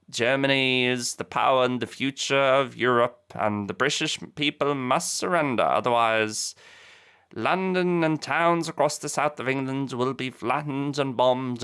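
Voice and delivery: paraphrase a Nazi broadcast in a hint of Lord Haw-Haw's accent; mock Lord Haw-Haw accent. Narrator voicing Joyce's threats to Britain, light mock Lord Haw-Haw accent. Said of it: "you'll excuse the accent".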